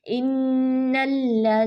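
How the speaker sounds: इन्नल्लज़ीन slow with firm doubled nun and lam; slow recitation; tajweed recitation. Shown as a demonstration. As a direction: hold the doubled letters in इन्नल्लज़ीन. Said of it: "मजबूती से".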